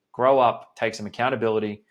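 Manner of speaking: ordinary talk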